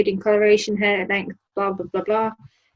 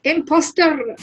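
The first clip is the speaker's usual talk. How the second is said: mock Scottish accusation; 6 semitones higher, 3 dB louder, slower than usual. Acting dwarf accusing the real one.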